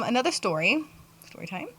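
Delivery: usual speaking voice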